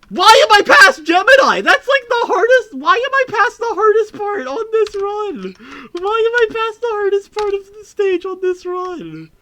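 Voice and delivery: high, loud astonished yelling; shocked disbelief. Real, not acted. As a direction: yell it in stunned disbelief at the run. Shocked at run progress.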